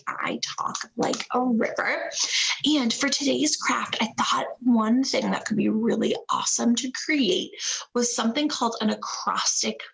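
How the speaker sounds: plain reading voice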